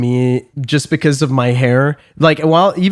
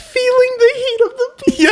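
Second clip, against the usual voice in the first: very high, squealed reaction; amused delight. Real, not acted. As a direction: squeal it, tickled by the story. Laughing along at the pizza story.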